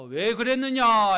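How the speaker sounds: loud, slow demanding question; stern divine question. Acted. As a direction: ask it slowly like a judge. God questioning Cain.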